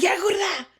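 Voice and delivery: high, loud mock telling-off; exasperated dad. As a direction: imitate a fed-up dad, laughing. Retold dad snapping at nosy daughter.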